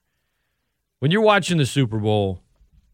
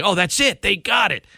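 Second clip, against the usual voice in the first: tag; 9 semitones higher, faster than usual. Retold or imitated. Mimics viewers, then scoffs.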